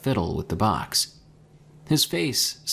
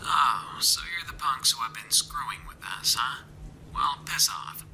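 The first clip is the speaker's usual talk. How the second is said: whispered radio voice; a hostile voice crackling over radio; 4 semitones higher, 5 dB quieter than usual. A narrator's reading. Stranger on the radio telling them off.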